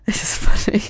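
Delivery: laughing under the breath; whispered laugh. Real, not acted. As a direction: giggle it in a whisper. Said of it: "I'm just laughing".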